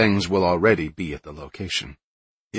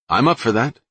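higher and louder, eager; enthusiastic; 6 semitones higher, 5 dB louder than usual. Acted. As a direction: jump at the offer. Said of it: "Matt said enthusiastically".

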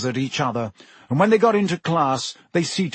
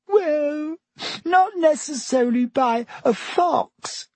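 high-pitched tearful voice; 13 semitones higher, slower than usual. A narrator's reading. Weeping Lavender answers Hermione's doubt, high and tearful. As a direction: answer defensively through tears. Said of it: "with streaming eyes".

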